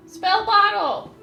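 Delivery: loud, high, slow shout; shouting character voice. Acted. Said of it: "Atosh said".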